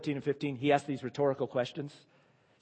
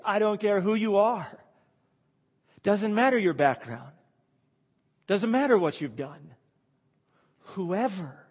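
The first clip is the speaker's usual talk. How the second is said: preaching force; 6 semitones higher, 6 dB louder than usual. Real, not acted. Preacher pressing the point.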